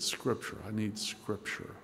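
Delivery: breathy whisper, barely there; a dying friend's whispered plea for Scripture. Retold or imitated. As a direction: whisper it like a dying man asking. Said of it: "the last word he whispered was".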